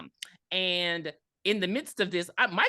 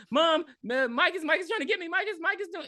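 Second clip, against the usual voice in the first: high, stammering plea to mom; mock panicked screaming; 12 semitones higher than usual. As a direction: imitate a frantic kid tattling in terror. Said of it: "he's, like, screaming".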